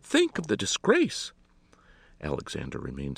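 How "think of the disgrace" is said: distraught lady, loud and pitched way up; wailing. A narrator's reading. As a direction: wail at him in scandalised distress. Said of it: "wailed Miss Jolly".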